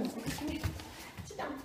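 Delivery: usual speaking voice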